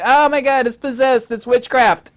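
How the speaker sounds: high, loud mock panic; a spooked 1600s peasant crying witchcraft; panicked villager. A 1600s villager finding a radio, loud high mock panic. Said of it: "somebody from the sixteen hundreds".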